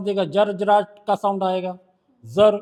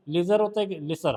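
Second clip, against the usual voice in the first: normal talking voice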